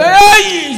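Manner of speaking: high loud ouch with a laugh; sudden pained ay breaking into a laugh; pain cry. Acted. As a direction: yelp then half laugh. Old man touched, cries out.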